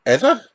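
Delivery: "ever" high and loud with a questioning rise; "ever" flung up high as a question; questioning own word. Real, not acted. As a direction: query the word "ever" as if doubting it. Queries his own word mid sign-off.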